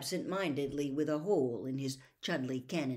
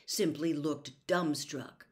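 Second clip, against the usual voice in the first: plain reading voice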